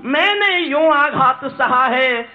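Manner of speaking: high, slow poetic cry; anguished verse. Acted. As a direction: cry the line out, stretching it. Same line high, later repeated steadily.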